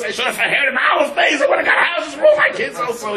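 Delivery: very high, strained wailing regret; mock tearful wail. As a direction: wail like a father who undersold himself. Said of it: "he's crying".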